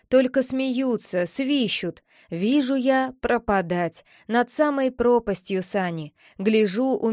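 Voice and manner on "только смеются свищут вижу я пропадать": ordinary delivery, nothing marked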